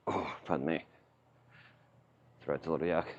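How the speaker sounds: normal talking voice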